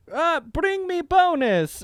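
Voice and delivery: high, slow mock German accent; drawn-out German-accented boss. Boss ordering bonus brought over, mock German accent. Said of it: "the boss was like".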